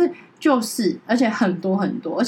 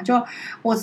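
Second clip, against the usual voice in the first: ordinary talk, nothing marked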